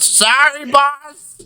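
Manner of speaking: put-on high-pitched little kid apology; chastened paperboy child. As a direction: pipe up an apology to the boss. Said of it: "And he just goes".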